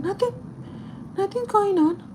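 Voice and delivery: high-pitched imitated grandma; grandma's denial. Voices grandma brushing off the question.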